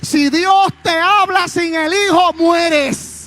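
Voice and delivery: preaching at full force. Acted. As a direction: pour it out loudly to the church. Shouted preaching and prayer.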